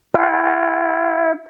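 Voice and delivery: a mock burp for the kids; playful pretend burp. Shown as a demonstration. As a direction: fake a silly burp. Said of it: "say burp together".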